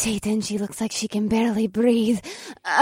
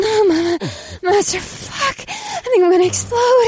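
high, halting cries near climax; breathless stutter; 10 semitones higher than usual. Acted. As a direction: gasp it out, barely breathing. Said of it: "She stuttered, and she could barely breathe".

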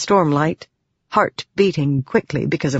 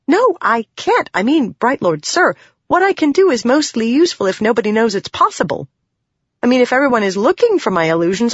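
flustered protest; 7 semitones higher, faster than usual. Acted. Shallan flustered, refusing.

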